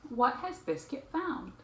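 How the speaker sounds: ordinary delivery, nothing marked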